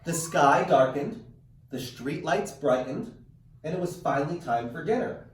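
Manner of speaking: lively read-aloud storytelling; animated picture-book narration; reading to children. A narrator's reading. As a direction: read it like story time for kids. Teacher reads picture book aloud.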